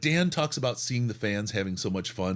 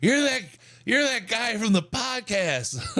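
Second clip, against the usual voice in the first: high, drawn-out drunk fan imitation; slowly recognize someone, as an excited drunk; tanked fan; 12 semitones higher than usual. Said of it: "he's tanked".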